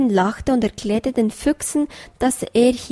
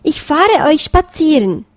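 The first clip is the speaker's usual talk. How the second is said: cheerful call; 6 semitones higher, 6 dB louder than usual. A narrator's reading. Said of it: "rief Kleinöhrchen".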